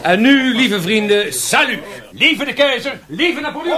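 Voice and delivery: high, loud festive toast; cheering salut to the emperor; rousing toast. Acted. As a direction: lift your glass and cheer it out. Raising a toast to Napoleon.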